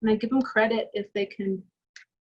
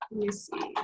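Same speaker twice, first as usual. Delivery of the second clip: soft unvoiced whisper, thinking aloud; whispered to herself; 8 dB quieter than usual. Real, not acted. Artist thinking aloud over her sketch, whispered. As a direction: breathe it out while studying the drawing.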